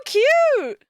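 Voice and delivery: very high, slow cooing squeal; gushing squeal. Real, not acted. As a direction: squeal with adoration, stretching the words. Gushing over cute mascot.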